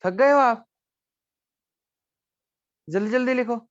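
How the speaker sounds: teacher teasing tired students to hurry up; teasing urge. Real, not acted. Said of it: "ये बच्चे थक गए हैं".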